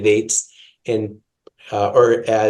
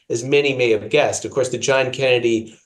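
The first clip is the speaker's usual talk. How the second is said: ordinary talk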